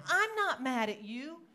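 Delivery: hammed-up baffled retort; protesting denial. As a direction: act out an insistent denial. Acts out own denial.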